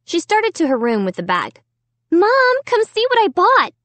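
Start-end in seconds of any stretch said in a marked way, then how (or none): 2.1-3.7 high bright call down the hall. A narrator's reading. Girl calling Mom to see her purchase, high call. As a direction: call to Mom from across the house. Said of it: "she called".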